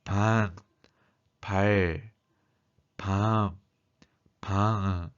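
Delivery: drawn-out syllables with blocked nasal resonance; pronunciation demo with the nose held shut; nose-pinched demo. Shown as a demonstration. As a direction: say each word singly, holding your nose. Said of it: "코 안이 울리는 것을".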